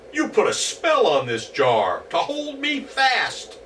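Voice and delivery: high, whiny goblin. Acted. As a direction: accuse him, trapped and indignant. Goblin accuses Herschel.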